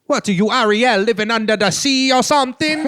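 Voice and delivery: loud, high mock Jamaican voice; Jamaican accent. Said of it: "the only way to do Jamaican accents".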